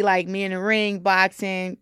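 usual speaking voice

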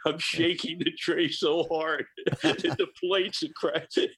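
high, slow giggling narration; amused laugh breaking into the words; laughing while telling. Real, not acted. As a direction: laugh through the memory of the rattling tray. Laughing about shaking the tray.

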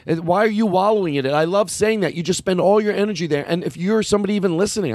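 ordinary delivery

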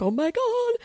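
very high, thrilled squeal-like exclamation; high-pitched giddy awe; retold excitement. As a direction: replay being overjoyed. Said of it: "I was so excited".

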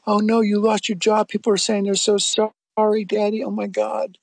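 a child mimicked wailing "Daddy"; imitating crying daughter. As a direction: play the daughter upset over the lost job, not full sobs. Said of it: "she started crying hysterically".

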